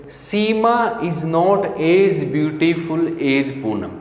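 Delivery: very slow, word by word; slow classroom dictation. Shown as a demonstration. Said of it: "teacher dictates slowly".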